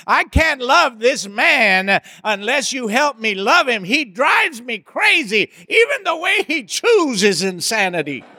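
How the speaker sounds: a wife laughing about her husband's chewing; exasperated wife. Acted. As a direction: play the fed-up wife praying. Voicing exasperated wife's prayer.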